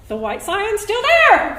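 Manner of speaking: thrilled high cry, slowed and drawn out; like a kid on Christmas morning; excited. Retold or imitated. Woman at the window sees the car, gleeful cry.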